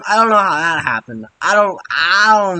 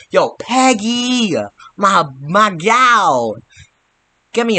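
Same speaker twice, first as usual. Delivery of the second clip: slow, stretched, higher "Yo, Peggy"; drawn-out call to Peggy; 4 semitones higher, slower than usual. Retold or imitated. Captain America asking Peggy for a plane, slow and drawn out. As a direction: drag the words out lazily like a cocky soldier. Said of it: "He's like".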